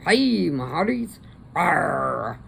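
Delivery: a child playing pirate; mock pirate growl from a toddler. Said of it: "Is she a pirate?".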